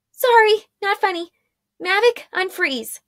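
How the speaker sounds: put-on a sheepish young heroine; young woman Arella. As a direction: apologize while trying not to laugh.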